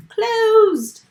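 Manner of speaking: big teacher emphasis on closed; emphatic answer. Shown as a demonstration. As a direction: land hard on closed like a reveal. Teacher emphasizes syllable type answer.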